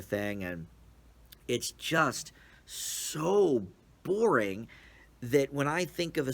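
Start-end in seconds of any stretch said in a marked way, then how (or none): 1.5-4.7 "boring" stretched out slowly, voice pitched up; drawn-out boredom. Acted. Reviewer groaning to viewers how dull the movie is, drawn out slowly. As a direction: drag the word "boring" out as if yawning.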